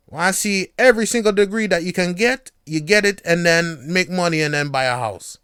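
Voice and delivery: loud Jamaican parent, patois. Retold or imitated. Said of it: "My patois is terrible".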